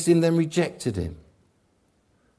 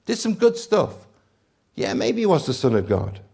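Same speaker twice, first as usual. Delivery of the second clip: casual admirer; 7 semitones higher, 4 dB louder than usual. Retold or imitated. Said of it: "A cry that said".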